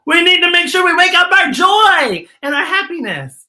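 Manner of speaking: high, loud rallying call; excited. Acted. Host rousing children at home, high excited shout. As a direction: wake everyone up with it.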